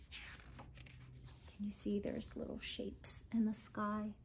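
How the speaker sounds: slow hushed whisper to the listener. Real, not acted. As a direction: lean in and whisper the question.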